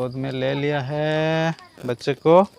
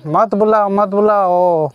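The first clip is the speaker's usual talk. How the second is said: higher-pitched, drawn-out repeated plea; raised "don't" call, slowing down; 5 semitones higher, 4 dB louder than usual. Real, not acted. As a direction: call it out, stretching the second one slow. Fending people off from the baby.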